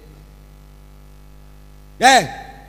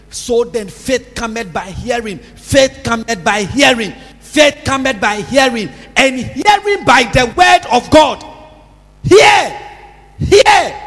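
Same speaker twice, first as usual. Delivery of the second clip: preaching at full force; 3 semitones higher, 15 dB louder, slower than usual. Real, not acted. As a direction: drive the verse home, louder each time.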